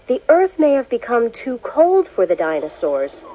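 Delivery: ordinary delivery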